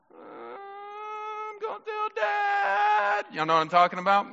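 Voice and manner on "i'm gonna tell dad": very high, drawn-out wailing tattle; whiny tattle. Retold or imitated. Preacher mimics the crying little sister threatening to tell Dad, high and slow. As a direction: stretch it out like a crying kid. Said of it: "what did she say to her sister".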